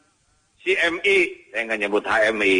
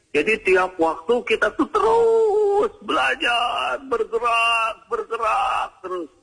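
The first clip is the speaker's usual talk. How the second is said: high, drawn-out preacher's cry; rousing exhortation; 13 semitones higher, slower than usual. Real, not acted. Preacher urging the congregation to keep learning, in a high rousing cry. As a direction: urge the crowd on with stretched, high-pitched words.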